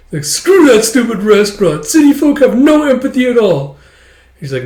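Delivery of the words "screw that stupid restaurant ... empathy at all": loud, high mock outrage; hammed-up angry complaint; indignant rant. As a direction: rail against them in a huff. Thrown-out brother rants at restaurant.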